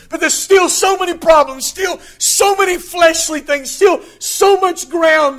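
preacher pressing the point home; preaching at full force. Acted. Preacher driving home human weakness, loud full-force preaching.

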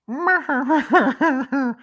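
high, loud staccato mock chuckle; put-on laugh that could pass for sobbing; imitated signature laugh. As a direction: imitate his high-pitched, stuttering cackle. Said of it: "when he goes".

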